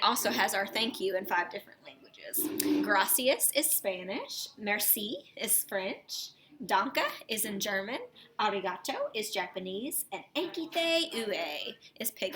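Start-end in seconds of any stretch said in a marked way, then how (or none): none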